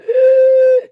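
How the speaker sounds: sharp high shocked gasp; loud gasp. Real, not acted. Host reacting to the clip, loud mock gasp.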